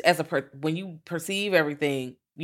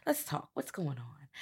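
soft coaxing voice; 14 dB quieter than usual. Retold or imitated. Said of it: "she was like".

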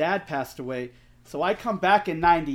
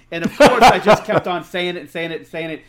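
loud laughing burst while telling it; real amused laughter rising over the words; 2 semitones higher, 10 dB louder than usual. Real, not acted. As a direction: laugh through the confession, much louder. Laughing while retelling the blunder.

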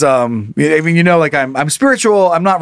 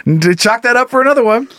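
normal talking voice

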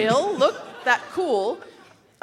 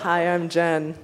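stiff robotic greeting; 4 semitones lower, slower than usual. Real, not acted. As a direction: say it flat like a machine. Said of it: "You said that like a robot".

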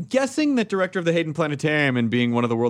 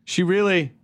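ordinary talk

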